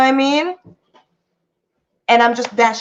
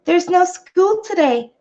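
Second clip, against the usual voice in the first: lady's calm news; 8 semitones higher, slower than usual. Retold or imitated. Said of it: "She's like".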